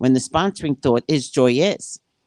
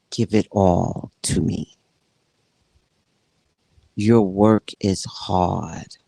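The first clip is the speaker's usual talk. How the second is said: low, slow, gentle prayer; humble prayer; 5 semitones lower, slower than usual. Retold or imitated. Praying to God.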